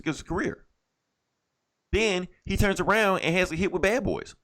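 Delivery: normal talking voice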